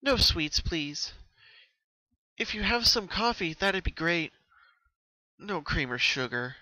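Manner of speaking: a hint of a tired groan, still high; weary, refusing treats; soft groan. Pinkie turning down sweets, mild groaning request. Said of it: "Pinkie softly groaned".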